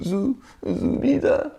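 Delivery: very high, slow, stretched call of Zoubida; mock a terrified husband calling out to his wife; mocking squeaky frightened husband. Preacher mocking a scared husband crying out for his wife, squeaky and high.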